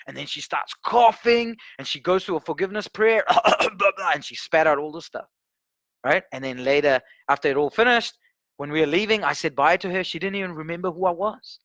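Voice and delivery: ordinary delivery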